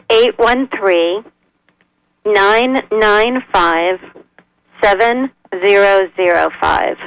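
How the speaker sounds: slow, spaced-out phone number; slow dictation. Real, not acted. Guest repeats the number for a caller to write down, slow dictation. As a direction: read it out digit group by digit group, pausing between.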